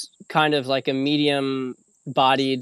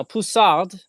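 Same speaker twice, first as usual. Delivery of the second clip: show-off French accent on the grape name; 5 semitones higher, 4 dB louder than usual. Shown as a demonstration. Wine lover playing French sommelier for viewers, French accent. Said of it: "trying to do this French accent".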